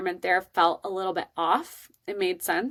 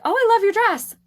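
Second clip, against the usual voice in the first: much higher and louder, sugary-sweet gush; gushing compliment; 9 dB louder than usual. Retold or imitated. Nervous mom gushes over the stern principal's dress, sugary high. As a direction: gush over her dress to break the ice.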